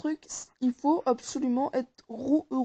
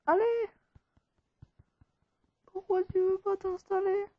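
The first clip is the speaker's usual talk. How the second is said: high-pitched plea to a stubborn machine; pleading coaxing; 7 semitones higher than usual. Real, not acted. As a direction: coax it along in a higher voice. Coaxing a stuck installation. (supplication d'une voix aiguë)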